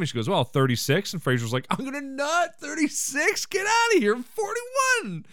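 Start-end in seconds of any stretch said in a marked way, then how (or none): 1.7-2.6 high, squeaky-pitched mock delight; mock flattered glee. Frasier thrilled by saleswoman's age guess, mock flattered glee. 2.6-3.5 very high, rising question; mock flattered disbelief. Frasier echoing the saleswoman's guess, mock disbelief. 3.5-5.2 very high, stretched-out mock delight; mock flattered glee. Frasier telling saleswoman his real age, mock giddy glee.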